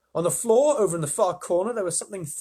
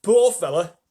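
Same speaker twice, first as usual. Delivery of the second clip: centipede voice; 5 semitones higher, 10 dB louder than usual. Acted. Centipede on the earthworm.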